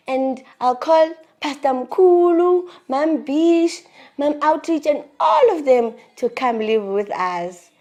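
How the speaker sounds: put-on high, loud child's big promise; imitated little boy. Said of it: "he said he was gonna be".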